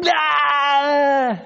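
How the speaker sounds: long, high, loud mock shriek; freaking-out scream. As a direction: scream in hammed-up panic. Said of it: "they're freaking out".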